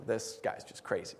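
breathy whispered thought; inner thought whisper. Retold or imitated. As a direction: mutter it under your breath as a private thought. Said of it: "he's thinking".

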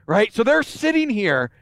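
usual speaking voice

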